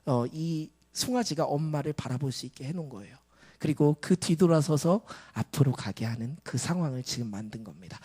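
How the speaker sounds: normal talking voice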